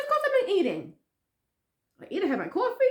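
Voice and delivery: high-pitched imitation of mom; put-on indignant mother; impatient mother. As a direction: brush off the question in a high voice. Voices mother's impatient answer.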